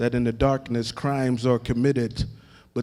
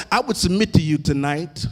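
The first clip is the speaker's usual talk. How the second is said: rising, shouted and half-sung preaching, much higher and louder; full-force preaching; 6 semitones higher, 4 dB louder than usual. Acted. Preacher building to full cry.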